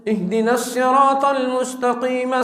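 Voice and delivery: hadr, swift flowing Quran reading. Shown as a demonstration. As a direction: move briskly through the verses in a chant. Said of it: "اب ہم نے تیز تیز پڑھا ہے".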